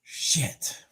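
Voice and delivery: breathy whisper under the breath; a relieved whispered curse. Real, not acted. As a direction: whisper it to yourself. Exhaling after excitement.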